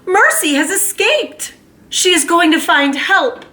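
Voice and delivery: high hopeful announcement; excited. Acted. Mrs Watson to her husband, high excited. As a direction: say it with rising hope.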